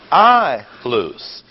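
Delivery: heavily underscored I before loose; stress on I. Shown as a demonstration. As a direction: punch a long I, let loose fall away. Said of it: "that's underscored".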